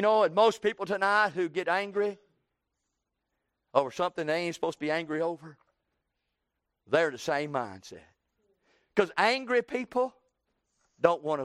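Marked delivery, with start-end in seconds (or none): none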